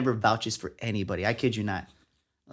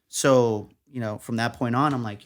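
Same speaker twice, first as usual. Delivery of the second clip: ordinary delivery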